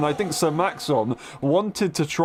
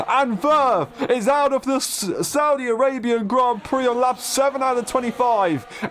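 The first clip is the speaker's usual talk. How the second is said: excited crash call, fading down; motorsport commentator; 9 semitones higher than usual. Real, not acted. Crash during live race commentary.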